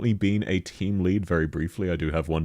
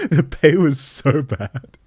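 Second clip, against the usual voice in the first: exaggerated complaint; 7 semitones higher, 6 dB louder, slower than usual. Real, not acted. Complaining, high loud slow.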